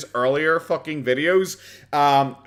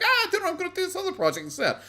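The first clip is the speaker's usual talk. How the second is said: much higher, fast, brushing the question off; evasive dodge; faster than usual. Retold or imitated. Streamer imitates himself dodging fans' questions, high and fast. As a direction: squeak out an excuse quickly. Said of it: "I'm like".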